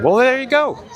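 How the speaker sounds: bright, pleased "there you go"; excited. Real, not acted. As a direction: announce it with delight. Excited reveal of showtimes.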